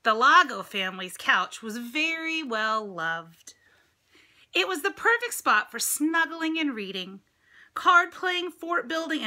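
bright storybook reading voice; lively picture-book narration; read-aloud narration. A narrator's reading. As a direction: read it to the children with lift.

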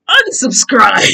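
mock subscriber shout. Mock angry viewer yelling at the vlogger. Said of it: "my subscriber goes".

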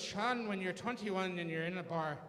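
lightly voiced Irish dad advice; a hint of an Irish father's brogue; Irish father advising his son on his knee, lightly voiced. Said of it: "and he goes".

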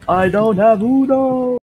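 high, slow, drawn-out cry; mock wail. Player repeating the Uno joke, high mock wail. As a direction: stretch it into a hammed-up high wail.

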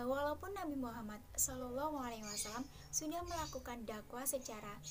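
usual speaking voice

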